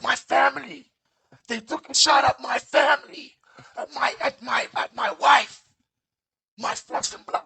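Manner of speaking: high, loud anguished cry for his family; distraught Frank Lucas. Retold or imitated. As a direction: wail it like a man whose family was shot at. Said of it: "and he's like".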